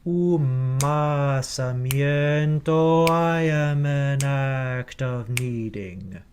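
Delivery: Umasamiento, I am run together with no glottal stop before I; blended, no glottal stop. Shown as a demonstration. Choir director demonstrating the vowel blend, slow and smooth. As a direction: glide the end of Umasamiento straight into I, slowly. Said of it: "like this".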